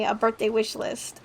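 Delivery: ordinary talk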